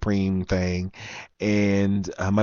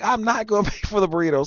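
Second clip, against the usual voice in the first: retold yelling, laughing; 11 semitones higher, faster than usual. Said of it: "So I'm yelling at him".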